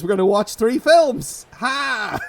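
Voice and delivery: hammed-up genie. Host revealing the ironic wish to co-host, hammed-up genie. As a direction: proclaim it like a gloating trickster djinn.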